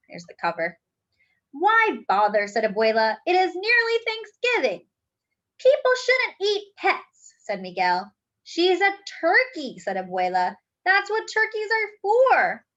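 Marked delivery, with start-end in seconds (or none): 1.5-12.7 lively, expressive read-aloud storytime voice; reading to children. A narrator's reading. Teacher reading a picture book aloud to the class, lively storytelling. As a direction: bring the picture book alive for the kids.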